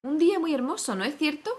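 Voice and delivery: high-pitched little creature making small talk. A narrator's reading. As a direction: pipe it up high as a chatty question.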